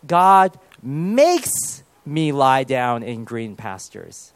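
"makes" hugely drawn out, then slow to the end; stress on MAKES. Shown as a demonstration. Preacher loving this line of the psalm, "makes" stretched out for emphasis. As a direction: lean long and hard on "makes".

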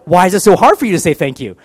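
loud, high, exasperated demand; indignant demand. Retold or imitated. Husband pressing his wife for thanks, loud and exasperated. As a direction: push her hard for the thank you.

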